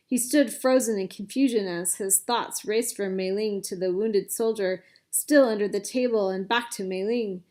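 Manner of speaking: ordinary delivery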